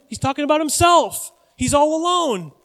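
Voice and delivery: high, loud exasperated emphasis; incredulous. Real, not acted. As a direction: drive it home in disbelief. Preacher incredulous at man's self-talk.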